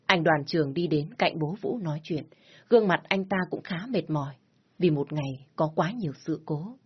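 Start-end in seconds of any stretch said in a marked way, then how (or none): none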